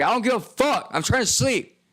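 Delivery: angry shelter guy. Retold or imitated. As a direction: snap at him like a grumpy roommate.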